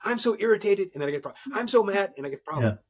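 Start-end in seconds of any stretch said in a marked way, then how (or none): 0.0-0.9 mock irritated employee. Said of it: "they typically give me the emotion". 1.5-2.1 higher, louder mock gripe; mock mad employee. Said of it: "they typically give me the emotion".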